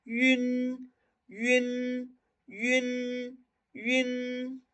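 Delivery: Mandarin syllable "yun" said slowly; pinyin yun. Shown as a demonstration. Teacher drilling students on Mandarin "yun". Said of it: "Como un sonido U con diéresis U N".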